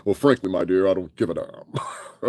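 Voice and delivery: put-on classic Hollywood leading man; mock old-movie actor. Mimicking the singer's Humphrey Bogart act, mock movie-star voice. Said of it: "Lose the Humphrey Bogart shit".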